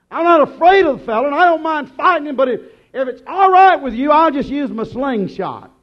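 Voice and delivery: fast, high and loud eager pleading; a boy rushing to convince the king. Acted. David eagerly begging Saul to let him fight.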